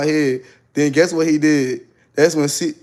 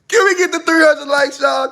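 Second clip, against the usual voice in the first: crying shout; 12 semitones higher, 4 dB louder than usual. Real, not acted. Begging viewers for likes.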